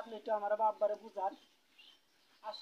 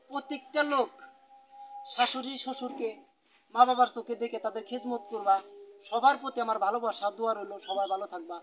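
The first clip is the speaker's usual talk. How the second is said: loud, measured appeal to an audience; public moral address; 10 dB louder than usual. Real, not acted. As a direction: deliver a closing message loudly, phrase by phrase. Closing moral address to viewers.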